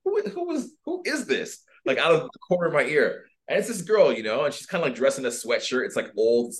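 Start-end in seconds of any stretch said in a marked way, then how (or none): 0.0-1.6 surprised. Retold or imitated. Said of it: "I'm like".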